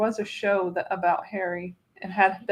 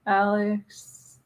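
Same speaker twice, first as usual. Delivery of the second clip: usual speaking voice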